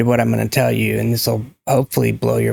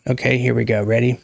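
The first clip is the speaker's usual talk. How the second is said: usual speaking voice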